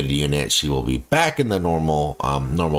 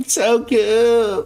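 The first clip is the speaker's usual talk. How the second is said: excited gushing; 14 semitones higher, slower than usual. Real, not acted. Gushing over cute character.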